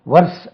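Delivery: "வர்ஸ்" with a short vowel and sounded r; readers' mispronunciation. Retold or imitated. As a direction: imitate readers saying "வர்ஸ்", r pronounced. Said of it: "வாசிக்கும் போது மக்கள் வந்து". ("வர்ஸ்" என ர் ஒலியுடன் தவறாக)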